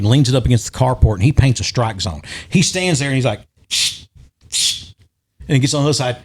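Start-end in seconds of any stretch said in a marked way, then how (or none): 3.7-4.9 soft breathy shh sounds; imitated shushing. Retelling his son pacing off the strike zone, soft shushing noises. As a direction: hush it twice like marking out a line.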